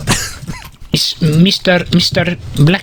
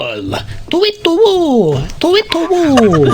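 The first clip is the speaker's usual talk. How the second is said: owl hooting; 8 semitones higher than usual. Pretend. Man introducing his pet owl, high owl hooting. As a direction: hoot it long and high like an owl. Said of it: "It's my pet owl".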